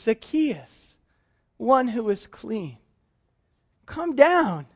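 softly calling his name, clean said slowly; gentle calling. Acted. Jesus calls Zacchaeus down from the tree, soft and gentle. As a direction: call him down gently, almost under the breath. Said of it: "And the Messiah says".

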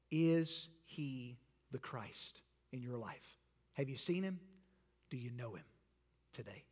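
normal talking voice

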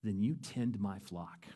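Jesus gently charging Peter, quiet and slow; soft, slow command. Retold or imitated. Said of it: "And he says".

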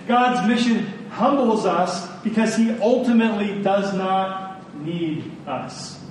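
ordinary delivery

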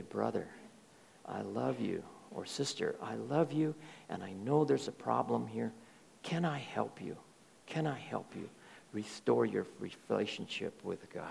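soft, low, gentle plea; a pastor gently approaching a struggling brother, softly; humble and meek. Retold or imitated. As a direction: humbly offer help, almost hushed. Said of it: "very humbly and very meekly".

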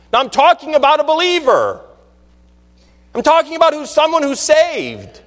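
high-pitched, insistent pulpit delivery; preacher's voice lifted high in pitch; emphatic preaching. Real, not acted. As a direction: drive the point home to the congregation, drawing out the key words.

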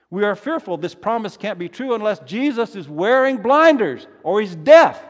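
loud, high, building to a shout; rising incredulous outcry; impassioned preaching. Real, not acted. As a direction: preach it forcefully, punching the last word. Preacher at height of sermon.

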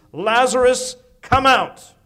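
high, booming command to the dead; loud cry. Acted. Jesus calls Lazarus out of the tomb, loud cry. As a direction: call him out at full voice. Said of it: "he cried out in a loud voice".

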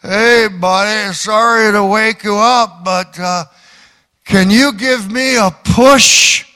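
a hint of a sloppy drunk begging at the door; lightly voiced drunk asking a favour; drunk man. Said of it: "a man staggering".

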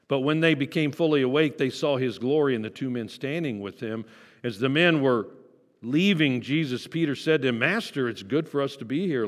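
Scripture reading. Acted. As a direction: read the passage out to the congregation. Reads Scripture aloud.

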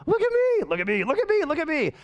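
starts very high, then swoops down and back up; mock attention-seeking. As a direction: mimic a selfie addict begging for notice. Mimics attention-hungry selfie takers.

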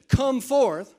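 "Come forth" called out loud and high; commanding call. Acted. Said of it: "If Jesus would have just gone to the tomb and said".

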